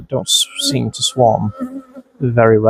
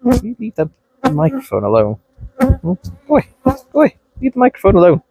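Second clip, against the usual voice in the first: high, exasperated shooing; scolding the bees; 10 semitones higher than usual. Real, not acted. Beekeeper to the bees buzzing at his mic, high exasperated shooing. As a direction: shoo the bees off the mic, pleading.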